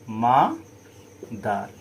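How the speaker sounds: Mother split slowly as মা, pause, দার; syllable by syllable. Shown as a demonstration. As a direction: stretch and separate the two syllables of mother. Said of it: "এখানে দেখো দুটো syllable আছে". (Mother ধীরে দুই ভাগে ভেঙে)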